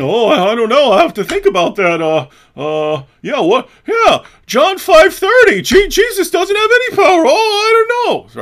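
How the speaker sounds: high, loud cartoonish panic; hammed-up dithering. Imagining a flustered man stumped by a question, hammed-up panic. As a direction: blurt it out in a high, strained, dopey voice. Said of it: "He might have been like that".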